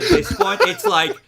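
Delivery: high, laughing through the words. Real, not acted. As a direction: giggle while talking. Laughing at the lucky rolls.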